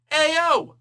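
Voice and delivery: high, booming "hey, yo"; loud call-out. Shown as a demonstration. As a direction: holler it out to get someone's attention. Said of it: "And I'm loud about it".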